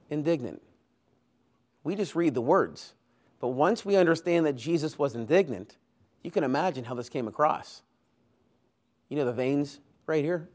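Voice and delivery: ordinary talk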